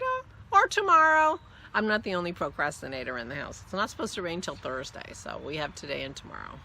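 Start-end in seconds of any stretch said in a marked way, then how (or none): 0.0-1.4 high, loud quoted reply; husband's reply. Retold or imitated. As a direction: toss off his answer brightly. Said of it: "He said".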